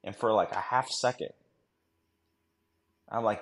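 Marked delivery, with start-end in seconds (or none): none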